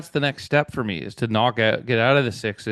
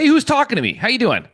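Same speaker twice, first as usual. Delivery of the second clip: mock startled greeting; 12 semitones higher, 6 dB louder than usual. Mock stiff-neck turn.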